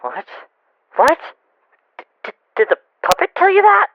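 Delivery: stunned, stammering, high-pitched disbelief; shocked. Acted. Fritz hearing Mike knows the killer, shocked. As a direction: voice leaping up, loud and incredulous.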